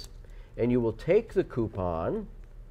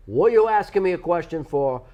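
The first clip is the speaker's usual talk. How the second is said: put-on louder, gruff New York accent; New York cop accent; 6 semitones higher, 6 dB louder than usual. Imitating NYPD answering a tourist in a New York accent. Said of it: "It's the New York accent".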